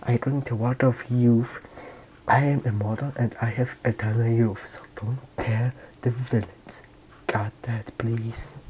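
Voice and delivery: soft secret whisper. Retold or imitated. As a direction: whisper it into her ear. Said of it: "Whispering to her, uh, ear".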